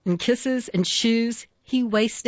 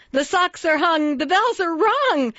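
high, pleading Santa; desperate cry; 9 semitones higher than usual. A narrator's reading. Santa pleads with the stubborn reindeer, desperate cry. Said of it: "cried Santa desperately".

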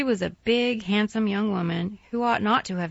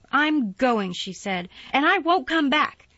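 higher, louder cold farewell; angry parting; 6 semitones higher, 5 dB louder than usual. A narrator's reading. Mary walking out on Colin, loud and angry. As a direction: storm off with the words.

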